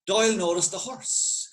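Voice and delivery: usual speaking voice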